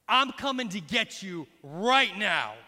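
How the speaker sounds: put-on loud, slow, firm words to the child; each word a deliberate threat; stern parental warning. Father calls the guilty child.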